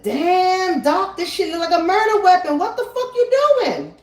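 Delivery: high, loud startled voice of the mover; mover's shocked reaction. Retold or imitated. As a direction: imitate the mover, caught off guard and loud. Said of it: "And he said".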